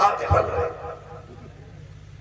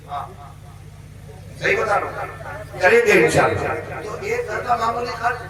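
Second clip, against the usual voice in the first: usual speaking voice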